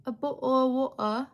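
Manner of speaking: British accent with dropped t's; mock a British accent, swallowing the t's; put-on British accent. Shown as a demonstration. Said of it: "I can only do posh".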